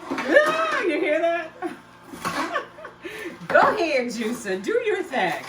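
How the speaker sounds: high, loud laugh through the words; delighted squeal of a laugh at the juicer; laughing with real glee; excited laughing. Real, not acted.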